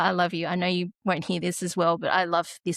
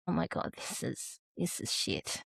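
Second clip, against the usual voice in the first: despairing whisper; 9 dB quieter than usual. Retold or imitated. Parent watching needles go in, whispering dread. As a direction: breathe it out in quiet despair. Retold despairing inner thought.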